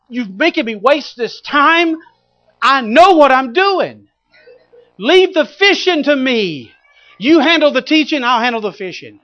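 mad as a hornet. Acted. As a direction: lecture him, fed up. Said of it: "He's mad as a hornet".

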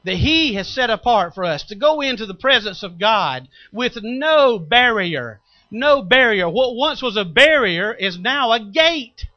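loud, high, soaring preacher's cry; rising revival preaching; fervent preaching. Acted. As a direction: preach it out with full force, pleading. Preaching at full force.

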